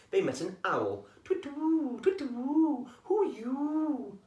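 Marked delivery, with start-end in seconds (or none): 1.2-4.2 high, slow owl hoot; high sing-song owl voice. Acted. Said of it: "hooted the owl".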